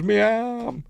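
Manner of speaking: high comic cry of pain; mock wail. The herald losing his arm, high mock wail. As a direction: squeal it like a cartoon victim. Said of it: "He goes".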